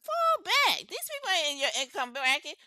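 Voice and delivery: high, punchy call to the back; emphatic call-out. Real, not acted. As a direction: throw it out loud for those at the back. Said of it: "for the people in the back".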